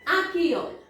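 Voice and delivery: normal talking voice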